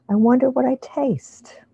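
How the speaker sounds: ordinary talk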